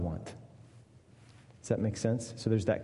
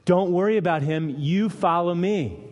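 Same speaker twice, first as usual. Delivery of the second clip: higher and louder, firm rebuke to Peter; firm Jesus rebuke; 7 semitones higher, 5 dB louder than usual. Acted. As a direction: set Peter straight, strong and firm. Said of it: "Jesus replies with some strong words".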